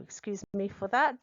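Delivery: ordinary talk, nothing marked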